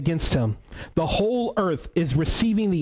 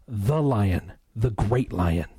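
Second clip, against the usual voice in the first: hushed beaver; 4 semitones lower, much slower than usual. Retold or imitated. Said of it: "This is a beaver speaking".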